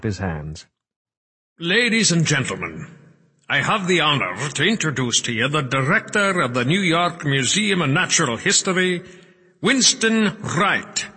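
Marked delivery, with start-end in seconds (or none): 1.6-11.1 high, projected ceremonial introduction; podium announcer. A narrator's reading. Cuthbert introduces the museum director, high announcer voice. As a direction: announce grandly, stretching out the final name.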